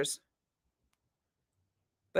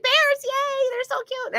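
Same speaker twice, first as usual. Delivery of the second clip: high squealing fangirl voice, much higher; imitating Tracy; slower than usual. Friend gushing over bears in a squeal. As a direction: gush like a bear-crazy friend. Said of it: "she's like".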